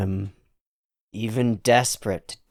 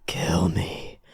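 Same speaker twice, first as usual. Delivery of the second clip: unvoiced whisper, pleading; whispered plea; 6 semitones lower, 6 dB quieter than usual. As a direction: breathe it as a plea.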